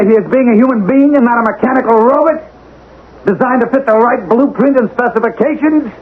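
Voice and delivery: rising, high, indignant protest; outraged tirade. Acted. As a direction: pour out his outrage at being discarded. Man rails against machine age.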